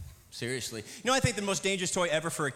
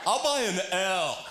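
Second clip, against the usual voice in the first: slow, louder proud declaration; dim contestant; 3 semitones higher, 3 dB louder, slower than usual. Retold or imitated. Comic's contestant proudly buys an L, slow and louder. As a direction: announce it proudly and slowly.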